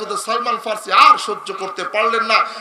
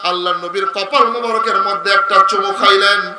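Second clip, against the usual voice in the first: melodic preaching; 7 dB louder than usual. Real, not acted. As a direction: chant the story, swelling into loud cries. Preacher tells story in melodic chant. (সুরেলা ওয়াজের টানা গলা)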